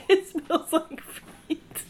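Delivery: slow laughing disgust; disgusted by smell. Real, not acted.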